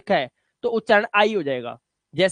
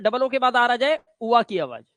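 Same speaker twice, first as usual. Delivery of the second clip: usual speaking voice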